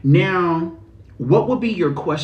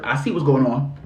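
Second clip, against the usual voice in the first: ordinary delivery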